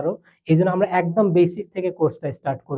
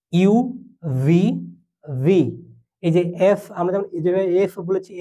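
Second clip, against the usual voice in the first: ordinary delivery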